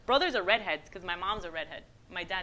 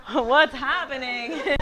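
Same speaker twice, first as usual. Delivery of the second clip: slow, higher, baffled mock voice; bewildered in-laws; 4 semitones higher, 4 dB louder, much slower than usual. In-laws lost at a loud party, imitated bewilderment. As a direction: stretch it out, laughing, mock bewilderment.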